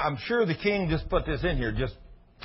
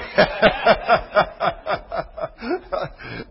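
long, loud laugh breaking into a cry; crying laughter; laughing to tears; 8 semitones higher, 7 dB louder than usual. Real, not acted. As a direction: laugh until it almost sobs.

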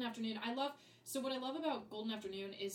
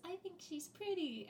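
little flower; 8 semitones higher, 4 dB quieter than usual. Retold or imitated. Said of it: "one of the little flowers goes".

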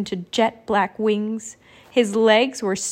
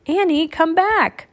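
high, slow, stretched call; 7 semitones higher, 4 dB louder than usual. A narrator's reading. Jack calling Annie back to the ledge, calling out. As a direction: call it out to someone flying away.

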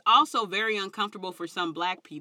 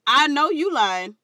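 loud blunt call-out; 6 semitones higher, 9 dB louder than usual. Retold or imitated.

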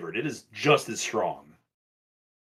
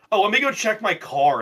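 imitated bit; 10 semitones higher than usual. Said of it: "then it evolved into, like".

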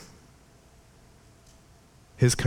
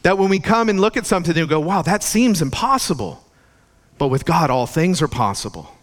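higher, louder impassioned sermon; fervent preaching; 5 semitones higher, 3 dB louder than usual. Acted. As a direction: preach it with rising fervor.

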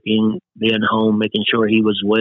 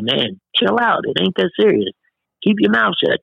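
high-pitched imitation of elders scolding him to chill out; mock scolding elders; 8 semitones higher than usual. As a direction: mimic people telling a young man to calm down. People scolding him as youth.

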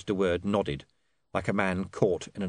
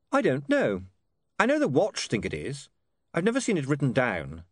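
lightly voiced, higher-pitched young man; stubborn witness; 6 semitones higher than usual. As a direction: quibble coolly with the commander.

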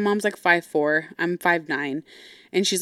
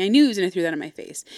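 ordinary talk

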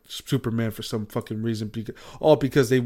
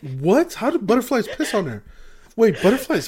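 high, incredulous questioning; real astonishment, loud and high; 10 semitones higher, 5 dB louder than usual. Real, not acted. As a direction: blurt it out in disbelief, pitch shooting up. Astonished that butterflies piss.

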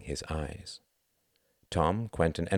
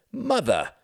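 a higher, louder startled cry; exclaimed; 14 semitones higher, 4 dB louder than usual. A narrator's reading. Son surprised to see his mother, exclaiming. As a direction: blurt it out caught off guard. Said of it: "he exclaimed".

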